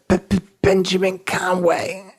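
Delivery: high, slow put-on stutter. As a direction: stammer helplessly to fool the police.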